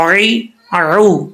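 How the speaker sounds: عین زبر را, عین زیر ری, عین پیش رو stretched slowly with a throaty عین; slow syllable drill. Shown as a demonstration. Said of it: "کیسے؟".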